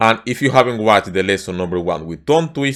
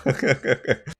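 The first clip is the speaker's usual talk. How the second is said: soft laugh; 2 semitones higher, 6 dB quieter than usual. Real, not acted. Laughs after failing twister.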